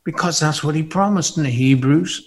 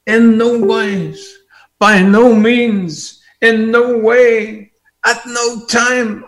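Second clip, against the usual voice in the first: impassioned preaching; 8 semitones higher, 3 dB louder than usual. Real, not acted. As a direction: build to a ringing high chant of never upon never. Preaches the promise at full force.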